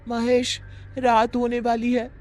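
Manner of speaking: put-on teasing girl voice. Friend teasing Mahesh about the witch, high mock-scared voice. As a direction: tease him with a put-on fright.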